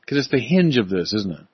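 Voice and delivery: normal talking voice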